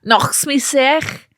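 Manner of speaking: slow, relished Scouse; drawn-out Scouse with throaty k; Scouse catchphrase. Shown as a demonstration. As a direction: linger on the Liverpool accent. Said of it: "a Liverpudlian Scouse thing".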